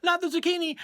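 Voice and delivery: very high, loud cry of comic panic; mock terrified yell. Burglar facing a giant zucchini, mock terrified yell. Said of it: "take one look at it and yell".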